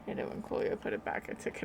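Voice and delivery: hushed aside, unvoiced; whispering. Real, not acted. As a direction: whisper it off mic.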